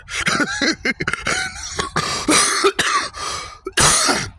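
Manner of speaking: long, very high breathless giggle; high squealing laugh. Real, not acted. To viewers after the Brainiac story, long high squealing laugh.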